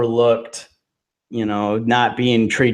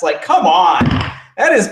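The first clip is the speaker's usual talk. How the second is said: frustrated outburst; 7 semitones higher, 5 dB louder than usual. Real, not acted. Venting about Emma's booking.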